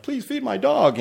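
high, pleading beggar voice; mock beggar. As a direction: plead as if begging on the street. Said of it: "I've seen people, you know, use kids".

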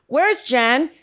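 high parent calling out; high-pitched mock yell; mock screaming. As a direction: holler for someone upstairs, imitating a parent. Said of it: "It's gotta be screaming".

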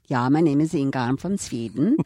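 lightly put-on Swedish accent; mock Swedish accent. Actress re-enacting her Inga line for the interviewer, mock Swedish accent. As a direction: play a comic Swede with a light accent.

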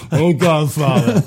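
slow, drawn-out crooner moan; exaggerated slow impression; Johnny Fontane. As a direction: stretch it out as a comic plea. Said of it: "you gave it too nasally".

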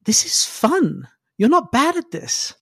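slow, teasing, rising high on the second line; taunting hacker. Acted. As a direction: needle them with mock praise. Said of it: "And he goes".

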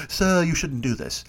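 lightly voiced old butler, slow and prim; butler Alfred. Alfred fretting at Batman over dessert, lightly voiced prim butler. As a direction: gently scold the master like a fussy butler. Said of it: "bring him creme brulee in the Batcave and go".

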